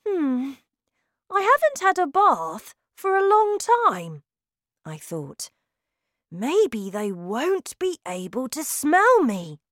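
put-on high, nervous boy thinking aloud; anxious boy voice. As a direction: fret like a frightened boy. Boy's anxious inner thought.

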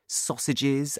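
usual speaking voice

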